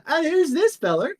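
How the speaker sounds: put-on high, loud, delighted old shopkeeper; a loud high folksy shopkeeper greeting. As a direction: pitch way up, tickled to meet him. [name] greets Bill.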